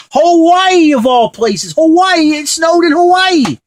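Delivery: high-pitched disbelief, rising to loud; incredulous rant. Pretend. Vlogger ranting about snow in Hawaii, incredulous. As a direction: squawk in amazement at the freak weather. Mock disbelief at freak weather.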